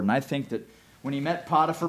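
usual speaking voice

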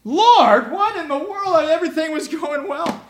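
high, loud cry of dismay to God. Acted. Joseph crying out to God after being framed, loud dismay. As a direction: exclaim in shocked disbelief.

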